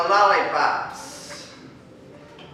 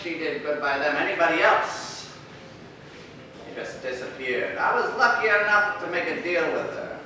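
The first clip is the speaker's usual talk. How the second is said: moody hard-boiled narrator voice; noir detective; 4 dB quieter than usual. Acted. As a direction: narrate like an old crime film.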